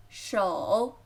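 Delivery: 手 low and dipping; careful third tone on 手. Shown as a demonstration. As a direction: dip the pitch down through 手. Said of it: "third tone".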